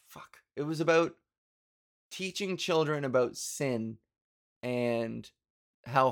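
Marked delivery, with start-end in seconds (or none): none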